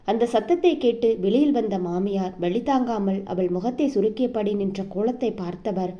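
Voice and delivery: ordinary delivery, nothing marked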